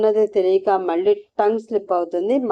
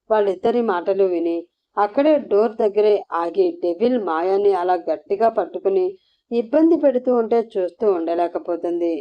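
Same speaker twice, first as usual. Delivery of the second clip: plain reading voice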